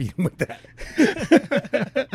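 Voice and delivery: high, very slow, stretched-out tease; hammed-up suggestion to beat him with manuals; drawn-out joke. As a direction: drag it out playfully, way up high.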